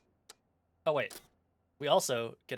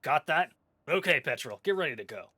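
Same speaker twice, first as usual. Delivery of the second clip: pirate captain; 3 semitones higher, 8 dB louder than usual. Acted. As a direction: send them off loudly. Captain sends crew out.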